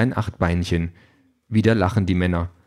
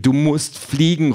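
raised, slow, insistent call; emphatic raised voice; urgent call; 7 semitones higher, 3 dB louder, slower than usual. A narrator's reading. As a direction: press them hard, pitch up. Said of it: "Peters Stimme ist am lautesten".

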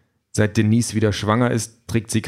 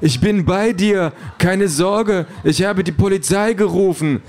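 high, urgent projected voice; alarmed boyfriend calling through speakers; Ken over loudspeakers; 10 semitones higher, 3 dB louder than usual. A narrator's reading. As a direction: call out reassurance at high pitch, drawn out. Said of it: "Kens Stimme erklingt über die Lautsprecher".

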